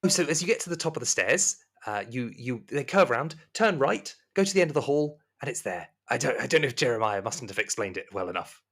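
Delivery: high, courteous voice giving directions; high-pitched, patient servant; helpful serving woman. Acted. Plays Rosemary in the game.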